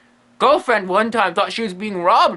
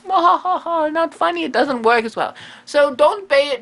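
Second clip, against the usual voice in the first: normal talking voice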